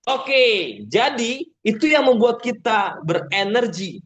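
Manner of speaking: high, slow, energetic projection. Shown as a demonstration. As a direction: sweep the room back to the topic. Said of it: "Kita naikkan intonasi kita, kita tinggikan volume kita".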